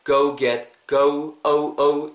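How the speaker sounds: "go get, go, oh, oh" with each o gliding into oo; drawn-out o glide. Shown as a demonstration. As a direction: model the o-u vowel piece by piece. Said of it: "약간 모음이 어". ("go, oh"를 '어우'로 끊어서 시범)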